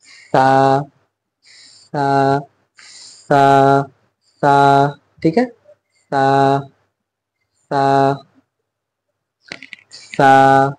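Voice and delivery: सा with the tongue lightly on the upper teeth, th sound; tongue touching teeth. Shown as a demonstration. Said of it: "बिल्कुल हल्का सा".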